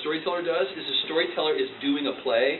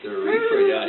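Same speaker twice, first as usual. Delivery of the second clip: surprised; 8 dB louder than usual. Real, not acted. Echoes dad's name for ghost.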